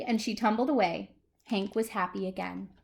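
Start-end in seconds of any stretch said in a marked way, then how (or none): none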